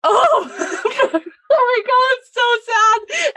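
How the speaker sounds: high, slow, cracking wail; breaking down while laughing; crying laugh. Retold or imitated. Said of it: "And I was like".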